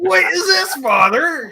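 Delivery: high, loud mock accusation. Son teasing his father about the hidden fuzz box, mock accusing. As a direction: catch your father out, teasing. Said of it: "I'm like".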